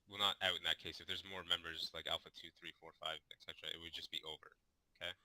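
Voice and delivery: ordinary talk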